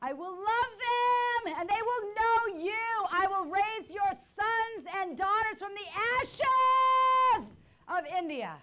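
high, wailing vow to God; tearful high wail, stretched out; sobbing cry. Retold or imitated.

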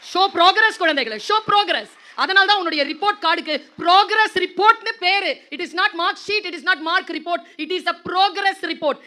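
high, loud, slow and emphatic; rally the hall like a preacher; rousing exhortation. Real, not acted. Speaker rousing students to show progress, loud high.

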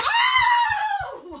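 long, high-pitched "oh"; drawn-out high yelp; high squeal. Real, not acted. Startled squeal at silly effects.

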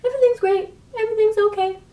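put-on very high, tiny childlike voice; little-kid voice. Vlogger acting out her comfort in the scent, little-kid voice. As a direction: pipe it up high, like a contented kid. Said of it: "makes me feel like a little kid".

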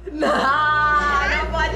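loud, slow, laughing no; laughing protest. Real, not acted. Cookie challenge going wrong, laughing.

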